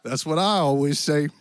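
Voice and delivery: put-on cowboy accent. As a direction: play a genial Western cowboy host. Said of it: "apparently some cowboy accent".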